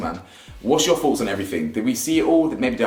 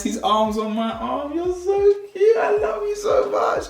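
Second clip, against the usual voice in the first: much higher, slow, doting coo; slower than usual. Real, not acted. Host interrupting his review to coo at his pet, high and doting. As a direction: gush like it's the cutest thing alive.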